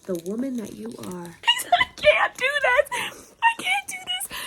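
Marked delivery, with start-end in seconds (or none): 1.8-4.4 very high, slow whimpering squeal; overwhelmed squeal. Real, not acted. Reader overcome by the knife scene, whimpering squeal. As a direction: squeal it way up high, near crying.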